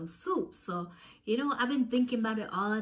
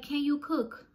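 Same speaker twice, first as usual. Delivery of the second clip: higher-pitched quick question; pointed challenge; 7 semitones higher, slower than usual. Retold or imitated. Confronting roommates at the meeting, pointed question. Retold challenge, first take.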